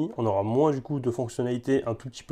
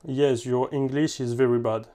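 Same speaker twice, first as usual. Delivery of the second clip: slow, French-accented English; French speaker's halting English; terrible English accent; slower than usual. Real, not acted. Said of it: "Mon accent anglais, il est terrible".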